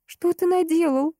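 high, horrified cry. Acted. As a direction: cry out in horror. Terrified girl confronts bloodied friend.

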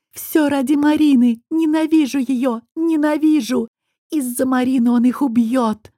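high loud tearful outburst of hatred; hysterical hate. A narrator's reading. Nayra screams her hatred of Marina at everyone. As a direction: spit out the hatred through tears.

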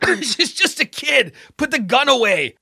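high, strained call. A narrator's reading. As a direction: call out urgently through pepper spray. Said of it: "Jesse called out".

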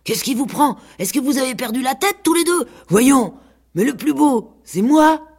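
high, puffing, agitated scolding; breathless. A narrator's reading. Pig breaking up the quarrel after running over, high and breathless. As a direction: pant it out after a gallop. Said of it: "leur dit tout essoufflé".

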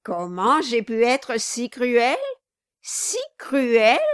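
loud, rising mocking echo; loud sneer at the pleading wife; scornful outcry. A narrator's reading. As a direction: toss her question back with scorn. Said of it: "s'écria le nain".

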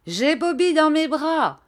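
high, loud joyful cry; cried out. A narrator's reading. Shepherdess crying out from the hole. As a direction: call it out in triumph. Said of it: "cria la bergère".

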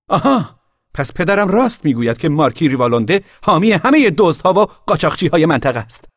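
high, loud triumphant cry; shouting. A narrator's reading. Boy shouting an accusation at the girl's family. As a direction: pounce on it with a shout. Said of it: "فریاد زد".

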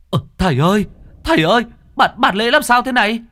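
alarmed cry to the master; panicked question. A narrator's reading.

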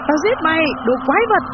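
high-pitched attacking shout; frenzied battle cry; furious yell. Acted. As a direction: scream it as you lunge to kill. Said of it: "rồi hét lên".

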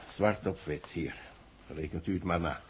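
usual speaking voice

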